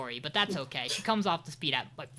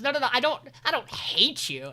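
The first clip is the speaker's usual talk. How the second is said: retold hasty backtracking, higher and louder; higher-pitched mock protest; flustered denial; 7 semitones higher, 6 dB louder than usual. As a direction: imitate someone hurriedly taking it back. Acted backtracking denial in retold scene.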